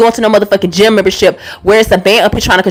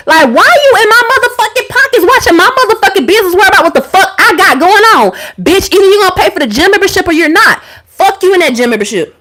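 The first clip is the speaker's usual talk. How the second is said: high, heated rant at her ex; venting at her ex about the gym card, exasperated; 7 semitones higher than usual. Real, not acted. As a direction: let the outrage pitch you way up. Ranting at ex about money.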